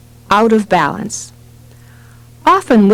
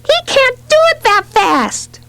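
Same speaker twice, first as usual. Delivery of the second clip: put-on high, squealed child's retort; delighted child's shriek. A narrator's reading. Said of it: "Debbie shrieked back".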